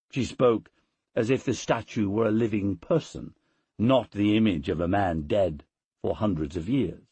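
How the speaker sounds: usual speaking voice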